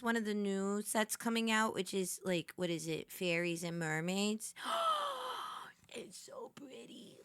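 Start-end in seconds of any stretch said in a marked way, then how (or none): 4.6-5.6 long, high intake of breath; delighted gasp. Real, not acted. Excited about pretty new card set. 5.9-7.2 slow, hushed, voiceless whisper; barely-there breath of admiration; awed whisper. Real, not acted. Hushed awe at new set.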